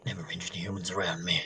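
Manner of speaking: hushed, threatening whisper low; low whispered menace. Acted. Voices the horse.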